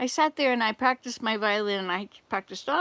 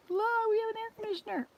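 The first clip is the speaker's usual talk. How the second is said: sing-song aside; 12 semitones higher, much faster than usual. Pretend.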